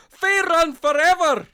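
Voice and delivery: loud and high rallying cheer; battle cry. Acted. Dwarf fighter rallying the table: shouted battle cry. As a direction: roar it out like a war chant.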